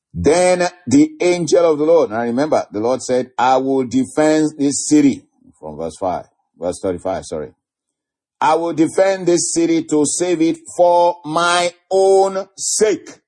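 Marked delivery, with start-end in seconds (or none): 0.1-5.2 high, ringing preacher's reading; God's promise, proclaimed in a raised voice; declaimed scripture. Acted. 8.4-13.2 high, slow, ringing proclamation; preacher voicing God from the pulpit; declaimed divine promise. Declaims God's words.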